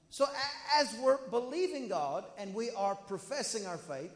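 ordinary delivery

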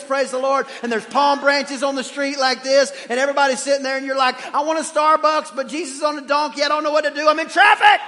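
high, loud, breathless-paced traffic panic; frantic mock rant. Father stuck in game-day traffic with Jesus, frantic rant. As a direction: build a comic holler to a peak.

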